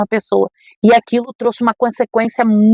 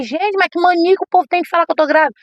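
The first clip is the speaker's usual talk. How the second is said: exasperated; 8 semitones higher, faster than usual. Retold or imitated. Fed up with pregnancy claims.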